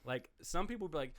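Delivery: normal talking voice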